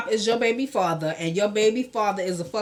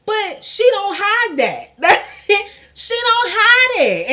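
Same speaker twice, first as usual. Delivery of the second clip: laughing; 9 semitones higher, 6 dB louder, slower than usual. Real, not acted. Laughing while talking about April.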